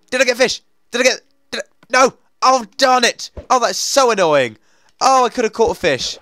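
high, loud outburst; frantic frustration. Real, not acted. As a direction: cry out as the catch slips away. Just lost a fish.

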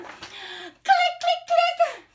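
high, loud snapping shutter sound; imitated camera clicks.

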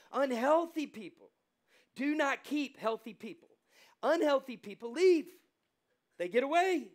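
loud, high, driving sermon delivery; pounding each point home from the pulpit; full-force preaching. Acted. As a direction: preach it hard to the congregation.

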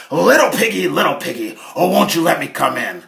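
loud, coaxing big bad wolf; sly wolf. Wolf knocks on Harold's door, loud and coaxing. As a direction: lure the pig out, loud and sweet.

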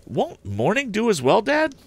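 a keen lad pressing his father; eager young Tom. Acted. Tom questions his father about the theft, high and eager. Tom's voice again.

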